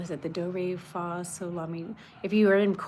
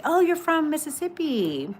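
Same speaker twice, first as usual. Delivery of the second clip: bright, high surprised exclamation, louder; 9 semitones higher, 5 dB louder than usual. Real, not acted. Reacting to a viewer from Mississippi, happily surprised. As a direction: pleasantly caught off guard by the comment.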